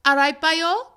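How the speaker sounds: put-on lilting Welsh "All right, boyo" with a rise on boyo; Welsh accent. Shown as a demonstration. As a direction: say "All right, boyo" in a strong valleys lilt. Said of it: "that's very Welsh".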